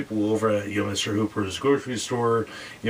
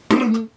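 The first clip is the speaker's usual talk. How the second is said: loud boom; 13 semitones higher, 8 dB louder than usual. Retold or imitated. Final plunk of the door.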